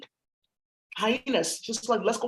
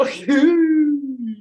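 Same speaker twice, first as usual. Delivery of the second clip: long, high, loud hoot of laughter; loud laughing whoop; 3 semitones higher, 7 dB louder than usual. Real, not acted. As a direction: let out one drawn-out high whoop. Sudden loud laugh-like cry.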